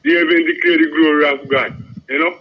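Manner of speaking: ordinary talk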